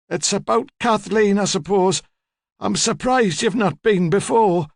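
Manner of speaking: put-on high, slow, haughty old lady; imperious old woman. Old woman receiving a detective at home, imperious. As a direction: hold forth in a high elderly voice.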